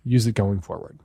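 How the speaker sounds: ordinary talk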